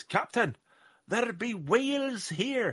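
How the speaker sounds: high, slow, hammed-up Scottish pirate accent; a bad Scottish sailor calling out to the captain, slow; terrible Scottish accent. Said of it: "that was a terrible Scottish accent".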